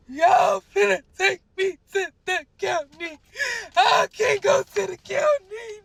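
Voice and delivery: loud, high, drawn-out crying re-enactment; panicked wailing. Retold or imitated. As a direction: wail in terror at being taken away. Retold panic in police car.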